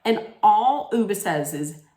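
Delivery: ordinary delivery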